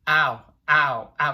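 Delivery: a hint of Bart Simpson going ow ow; mild mock cartoon ow's; Bart getting shocked. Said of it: "and he goes".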